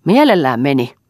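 loud, spiteful yell; furious shout. Acted. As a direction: hurl it at him in rising rage. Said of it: "huusi emäntä yhä enemmän raivostuen".

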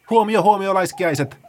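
high, loud call across the forest; shouting. Acted. Paula shouts to wake the sleeping sloths. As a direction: shout so the woods ring. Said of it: "Paula huusi niin, että metsä raikui".

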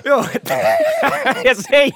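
high burst of laughter; laughing. Real, not acted. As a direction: crack up mid-sentence. Laughing at the crash.

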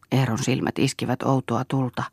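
ordinary delivery, nothing marked

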